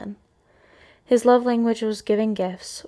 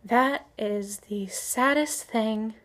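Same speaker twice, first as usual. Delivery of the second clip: ordinary talk, nothing marked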